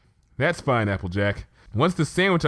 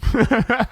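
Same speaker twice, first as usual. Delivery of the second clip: bright burst of giggling; fit of giggles; 11 semitones higher, 5 dB louder than usual. Acted. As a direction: giggle helplessly at the silly idea. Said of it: "a fit of giggles overcame her".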